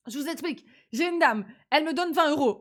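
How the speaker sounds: ordinary talk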